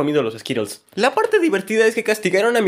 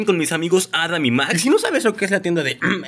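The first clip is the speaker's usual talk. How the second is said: ordinary talk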